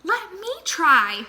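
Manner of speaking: a lightly voiced flamingo asking for a turn; eager flamingo. A narrator's reading.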